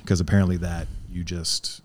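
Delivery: ordinary delivery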